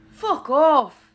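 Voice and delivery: growled. A narrator's reading. Remus growling at Sirius to leave him alone, angry. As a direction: growl it out, furious. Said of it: "Remus practically growled".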